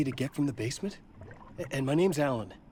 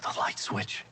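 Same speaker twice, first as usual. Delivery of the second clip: whisper; 6 dB quieter than usual. Acted. Lost in the dark, fighting.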